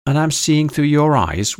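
wondering, incredulous voice. A narrator's reading. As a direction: ask it in disbelief, pitch lifted high. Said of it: "whispered Kara incredulously".

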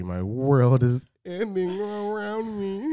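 slow, high, hammed-up lament; mock despair. Man turning thirty mourns his age, hammed-up slow wail. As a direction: wail it like a tragedy, tongue in cheek.

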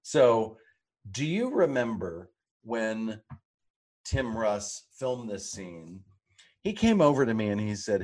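ordinary talk

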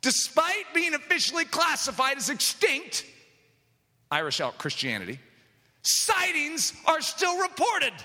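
preacher turning a quoted fact into a rallying cry; preached proclamation. Acted. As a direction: proclaim it from the pulpit as a triumphant reveal. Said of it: "But guess what?".

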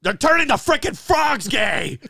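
high, loud mock outraged yell; a hammed-up outraged rant; Alex Jones shout. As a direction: bellow it like a conspiracy ranter. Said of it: "Alex Jones".

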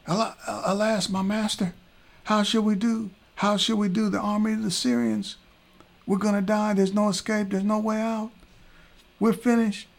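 high, frantic wail of panic; a servant sure they're all about to die; panicked servant. Acted. Servant Gehazi crying to his master Elisha, high panicked wail.

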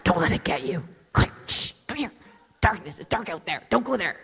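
urgent hushed warnings in short bursts; mock panicked whisper. Christians hiding from the dark, hammed-up frightened whisper. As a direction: hiss hurried orders to hide.

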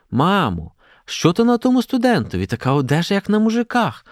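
put-on very high girlish cry; shocked exclamation. A narrator's reading. Olha to mother about a student's clothes, crying out. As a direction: exclaim it aghast, pitch leaping up. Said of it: "аж крикнула Ольга".

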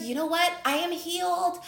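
mock the fake moment of being healed; mock naive self. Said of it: "then you're gonna be like".

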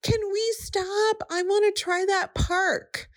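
put-on high, pleading child voice; a young daughter asking to stop; little girl voice. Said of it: "she would say".

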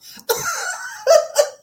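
high, loud cackle at her own story; loud laughing fit over her own joke; high burst of laughter. Real, not acted. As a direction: break into a big laugh. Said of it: "Ooh, that's so funny".

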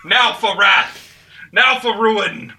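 very loud, high, slow war shout; epic battle cry. Pretend. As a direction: roar a rallying speech with mock gravity. Parody Théoden battle speech.

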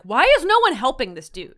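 high, loud mock outrage; exasperated. Reader reacting in notes to nobody helping, exasperated. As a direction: exclaim in disbelief.

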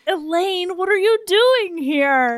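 high, exclaimed greeting; retold surprise. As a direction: act out amazement at an unexpected visitor. Acted surprise greeting to his mom.

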